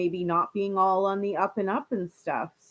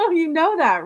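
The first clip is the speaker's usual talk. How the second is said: laughing; 6 semitones higher, 6 dB louder than usual. Real, not acted. Complimenting a viewer.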